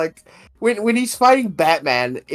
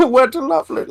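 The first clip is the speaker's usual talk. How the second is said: high, lilting villain voice; put-on singsong pitched well up; Bane; 9 semitones higher, faster than usual. Man showing his go-to sandwich-making catchphrase, Bane voice. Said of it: "I'll switch to Bane".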